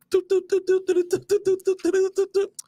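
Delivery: high, rapid doot-doot teletype jingle; mock news intro sung on one high note; newsreel jingle. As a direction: imitate a breaking-news ticker in high beeps. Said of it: "I need the newsreel".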